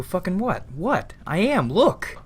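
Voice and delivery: frantic defensive pleading; defensive outburst. Acted.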